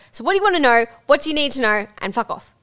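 loud and high, dropping to a curt dismissal; mock brusque guide. Guide mocking own tour manner, brusque with the group. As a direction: fire off questions, then dismiss them. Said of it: "I'm like".

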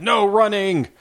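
put-on high, loud shout of a teen attendant. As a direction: yell the rule across the arena. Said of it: "there being like".

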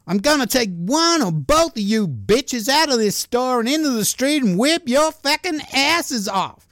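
high-pitched, hammed-up angry tirade; Trina hurling abuse. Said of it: "Trina was with McElroy and started hurling abuse".

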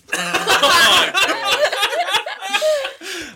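high, long laughter. Real, not acted. Laughing at own plan.

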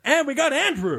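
high-pitched hyped introduction; over-excited roll call; high-energy host. Real, not acted. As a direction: announce the co-host with huge energy. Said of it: "[name] has so much energy tonight".

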